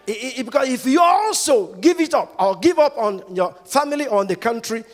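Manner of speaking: loud, high shouted preaching; preaching at full force. Real, not acted. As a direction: drive the warning home from the pulpit. Preaching climax.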